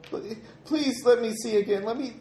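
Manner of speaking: put-on high, desperate begging child; pleading girl. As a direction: beg in a small scared voice.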